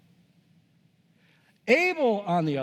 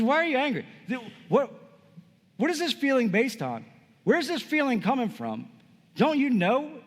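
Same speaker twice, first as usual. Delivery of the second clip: higher-pitched, insistent questioning; acted pleading challenge; 7 semitones higher than usual. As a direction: press him as if he already knows better. God questioning Cain, acted out.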